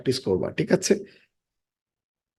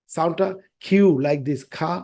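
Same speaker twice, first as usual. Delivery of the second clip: ordinary talk, nothing marked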